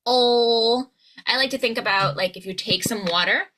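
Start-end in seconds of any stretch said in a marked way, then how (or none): 0.1-0.9 "Oh" as a held dark L deep in the throat. Shown as a demonstration. Coach models the dark L, held far back in the throat. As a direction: hold "Oh" back in the throat like "ull". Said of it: "feel it deep in your throat".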